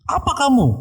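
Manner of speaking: high-pitched challenge from a possessed person; orang kerasukan setan. Retold or imitated. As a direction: jerk the pitch far up, as the demon sneering a challenge. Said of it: "ini yang dirasuk setan ini ngoceh". (menantang melengking seperti orang kerasukan)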